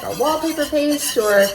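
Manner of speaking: ordinary talk, nothing marked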